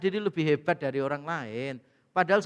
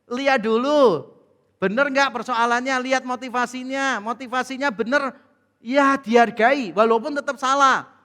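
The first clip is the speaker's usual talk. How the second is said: raised, higher-pitched exhortation; emphatic preaching; 5 semitones higher, 5 dB louder than usual. Real, not acted. Preacher urging listeners to check motives first, forceful exhortation. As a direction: press the advice home from the pulpit.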